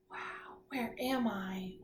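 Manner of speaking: breathless whispered gasp of wonder; surprised gasp. Shown as a demonstration. Teacher acting out a waking seal pup, awed whisper. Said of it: "show me what you think it looks like".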